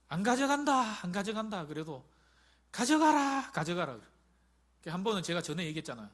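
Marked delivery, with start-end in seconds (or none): 0.1-1.7 loud insistent refusal, a mild mock; raised playful protest. Retelling refusing mother's gifts. 2.7-3.9 loud, high pushy mother's voice; mother urging, loud then easing off; mother insisting. Retold or imitated. Mother insisting he take food.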